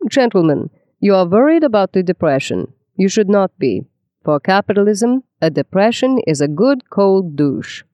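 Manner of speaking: put-on a touch of Viennese accent, grand lecturer tone; light Viennese accent. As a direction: play Schumpeter addressing his Harvard class in a hint of a Viennese accent, slowing on the last phrase. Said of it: "announce to the startled class in his Viennese accent".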